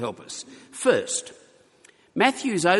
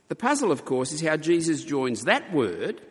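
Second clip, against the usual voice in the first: usual speaking voice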